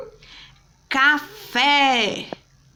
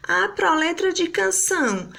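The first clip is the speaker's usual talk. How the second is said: lightly voiced pupil, higher pitch; pupil calling the teacher; 7 semitones higher than usual. Pupil asks teacher about song lyrics, lightly voiced and higher.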